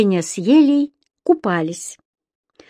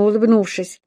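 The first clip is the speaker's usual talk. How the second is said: plain reading voice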